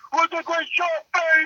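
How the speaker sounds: high-pitched catchphrase impression; Dick Vitale. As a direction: imitate an over-excited TV commentator. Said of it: "he's like".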